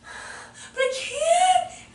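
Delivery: put-on very slow, high, whimpering kid whine; mock tearful kid; whiny little boy. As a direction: drag it out like a boy about to cry. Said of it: "he was like".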